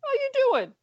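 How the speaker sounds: high sing-song repeated greeting; mimicking greeting. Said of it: "doing the "How you doing" back and forth".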